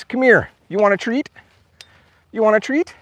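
high-pitched coaxing to dogs; coaxing pet talk. Real, not acted. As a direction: lure the dogs over in a high voice. Coaxing dogs back with treats.

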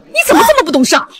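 high-pitched, rapid reproach; sharp, hurried blame; heated scolding. Acted. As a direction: lash out in exasperation. Mother scolds again as breakup lands.

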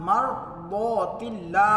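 मरदौतिल्ला drawn out very slowly with full heavy द; teacher models मरदौतिल्ला with heavy ض; slow recitation. Shown as a demonstration. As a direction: stretch out मरदौतिल्ला syllable by syllable. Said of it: "फिर आगे देखिए मरदौतिल्ला".

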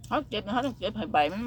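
ordinary talk

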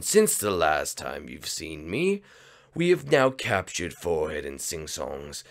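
low, slow gloating villain voice; villain narrator. Acted. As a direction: gloat to the audience like a scheming villain. Villain addresses the audience.

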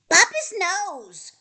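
high, loud egging-on cry; little rabbit's shout. A narrator's reading. Littlest rabbit butts into the quarrel, high shout. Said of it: "shouted Littlest Rabbit".

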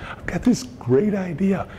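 a hint of a crazy man with an idea; mock overexcited. Pitching a coworker, mock overexcited. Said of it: "like a crazy man".

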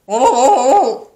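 loud, high, wobbling gobble; turkey gobble. A narrator's reading. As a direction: gobble in mock dismay. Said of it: "said Turkey".

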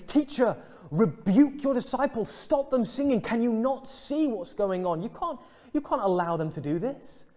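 high, loud indignant objection; unhappy Pharisees. Acted. Pharisees telling Jesus to silence his disciples, acted indignation. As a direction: act out scandalised Pharisees demanding a stop. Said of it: "they're not happy about this".

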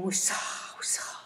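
whispered snake hiss; slithering hiss. A narrator's reading. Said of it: "who came slithering".

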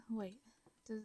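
usual speaking voice

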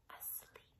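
hushed whisper dying away to nothing; whisper fading. Acted. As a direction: let it fade out like a sleeping child. Lullaby line fading out.

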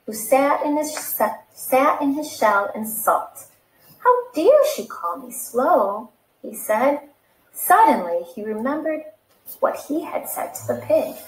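lively read-aloud storytelling voice; animated picture-book reading; story narration. Acted. Reads picture book to children.

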